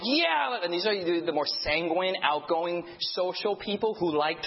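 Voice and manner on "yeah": high excited cheer; mocking eager volunteers with a high cheer; eager yes. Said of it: "courageous people that are like".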